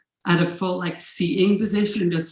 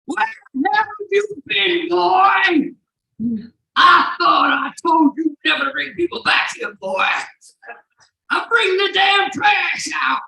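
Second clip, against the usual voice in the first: high, loud, full-voiced angry shouting; hammed-up furious dad holler, words stretched out; aggressive dad; 12 semitones higher, 7 dB louder than usual. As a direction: imitate an irate American father bellowing at his son. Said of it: "very aggressive, red-faced man".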